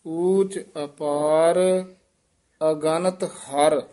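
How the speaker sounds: slow ਊਚ ਅਪਾਰ, then a clear pause before ਅਗਨਤ ਹਰ; pause after ਅਪਾਰ. Shown as a demonstration. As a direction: break the line after ਅਪਾਰ. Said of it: "ਇੱਥੇ ਵਿਸਰਾਮ ਲਾਉਣਾ ਹੈ". (ਅਪਾਰ ਤੋਂ ਬਾਅਦ ਵਿਸਰਾਮ)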